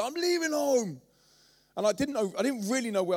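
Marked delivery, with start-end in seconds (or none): 0.0-1.0 a hint of a huffy little kid, high and slow; lightly voiced defiant toddler. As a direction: stomp off like a sulky child. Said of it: "stormed out the house and said".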